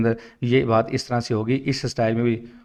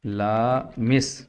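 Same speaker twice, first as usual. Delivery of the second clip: लामिस stretched very slowly; slow modeled pronunciation; drawn-out word; much slower than usual. Shown as a demonstration. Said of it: "मिसाल के तौर पे लामिस है".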